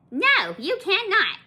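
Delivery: high, loud, slow put-on lady refusal; mocking rude lady. Rude shopper refuses extra packs, slow high mock. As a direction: drag out the snooty no, pitched high.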